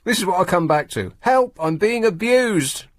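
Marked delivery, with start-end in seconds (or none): 1.2-2.8 mock cry for help. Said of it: "mock plea about co-host".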